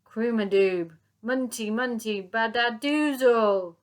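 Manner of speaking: loud, slow gurgling sounds; tummy rumble noises. Acted. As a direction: drag it out like a rumbling belly.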